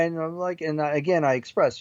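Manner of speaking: normal talking voice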